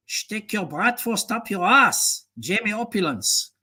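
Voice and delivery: ordinary talk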